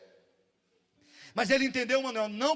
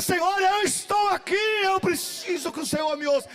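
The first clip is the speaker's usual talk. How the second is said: a higher, louder cry to the Lord; loud prayer; 7 semitones higher, 4 dB louder than usual. Shown as a demonstration. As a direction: pray it out so He hears.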